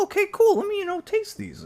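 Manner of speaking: very high, squeaky mock voice; mock eager drinker. The speaker mocks a drinker trying flavours, very high voice. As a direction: squeak it out like a naive customer. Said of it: "And you go".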